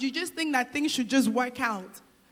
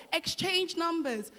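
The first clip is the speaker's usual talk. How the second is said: usual speaking voice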